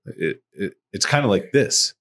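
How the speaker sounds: ordinary delivery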